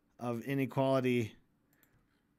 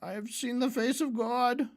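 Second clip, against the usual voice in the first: mock grandiose; 8 semitones higher than usual. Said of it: "he's obviously a lunatic".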